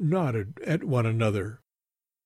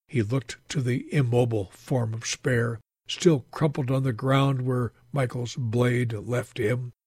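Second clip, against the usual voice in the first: usual speaking voice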